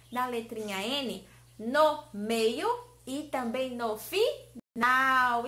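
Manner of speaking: usual speaking voice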